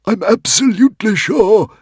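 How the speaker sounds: high, loud anxious quiver; anxious gabble. A narrator's reading. Dragon insisting to Hiccup, high anxious gabble. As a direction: gabble it out nervously. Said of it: "gabbled the Woden's Fang in a quiver of anxiety".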